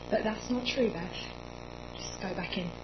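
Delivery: soft, low, gentle insistence; calm mum. Retold or imitated. Mum calming her daughter, soft and low. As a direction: calmly talk her round. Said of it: "And she said".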